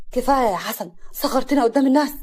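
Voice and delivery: loud, exasperated scolding; yelling. Acted. Mahmoud scolding his brother before the guests, yelling. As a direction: raise the voice to shame him into stopping. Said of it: "محمود بزعيق".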